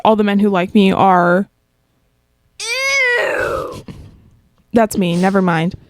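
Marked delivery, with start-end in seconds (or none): 2.6-3.8 high shouted ew; disgusted. Acted. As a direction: squeal out your disgust. Disgust at men who like her.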